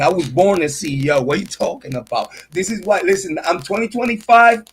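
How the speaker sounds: ordinary delivery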